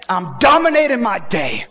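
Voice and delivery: I'm dominating my day, proclaimed high and strong. Shown as a demonstration. Congregation proclaiming victory, loud and high. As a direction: shout the declaration. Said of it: "Because you said".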